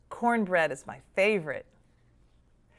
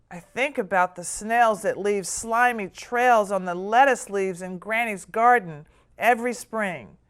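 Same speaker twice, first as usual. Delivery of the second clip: plain reading voice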